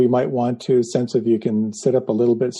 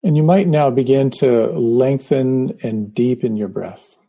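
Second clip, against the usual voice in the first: guided meditation. Acted. Leads a guided breathing meditation.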